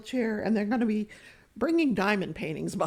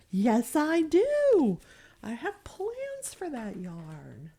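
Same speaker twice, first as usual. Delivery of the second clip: put-on stubborn old lady; 3 semitones higher, much slower than usual. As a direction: drag out the yes, defiant. Old her defending yarn.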